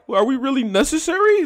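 high-pitched mocking question; mock self-doubting media. Host mocking media's self-assessment, high imitated voice of doubt. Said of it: "this weird self-assessment where they like".

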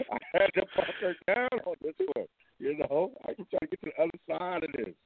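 muffled bad phone line; garbled like a bad connection; underwater. Real, not acted. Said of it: "You sound like you're underwater".

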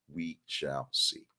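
slow, soft and ominous; ominous we shall see. Acted. Host teasing viewers about the launch, slow and ominous. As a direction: let it hang, slow and quiet.

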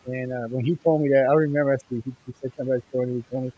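muffled mic, bass only. Real, not acted. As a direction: sound muffled through a bad mic. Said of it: "You sound muffled".

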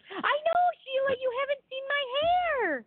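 excited. Real, not acted. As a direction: gush about it to a friend. Excited about her hair.

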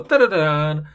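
normal talking voice

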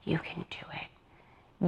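soft, slow hushed encouragement; a friend coaxing the dancer onstage in a slow whisper; mock whisper. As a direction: whisper it slowly to calm her. Friend reassures dancer before the show.

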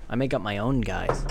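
usual speaking voice

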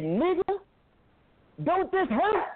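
high, mocking taunt of a cruel master; master's taunt. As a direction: sneer it up high like the slave master. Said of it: "he said".

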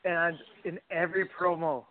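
usual speaking voice

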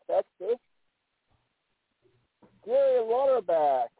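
ordinary talk, nothing marked

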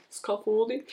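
"Scaffolding" with a rising, questioning tune; doubtful pronunciation. Real, not acted. Host doubting how she says a word, "Scaffolding" as rising question. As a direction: query your own saying of "Scaffolding". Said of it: "You pronounce so many words wrong today".

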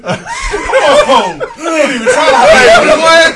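shouting through big laughter; laughing shout. Real, not acted. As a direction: yell it while cracking up. Laughing at a friend's yawn.

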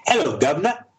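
high-pitched mock British accent; attempted British accent. Shown as a demonstration. American guest showing the host his attempt, hammed-up British accent. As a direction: have a jokey go at a cheeky British accent. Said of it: "Do you wanna give us a go?".

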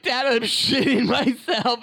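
put-on high, slow, laughing through a childish confession; laughing kid voice. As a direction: giggle out the confession as a little boy.

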